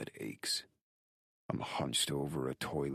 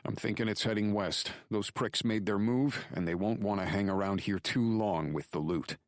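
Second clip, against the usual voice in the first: higher-pitched, urgent report; Drake reporting urgently; 7 semitones higher, 4 dB louder than usual. Acted. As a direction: relay what you found over the phone. Voices Drake reporting by phone.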